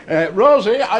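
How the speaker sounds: tipsy friend calling the barmaid over. Acted. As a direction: merrily hail the barmaid.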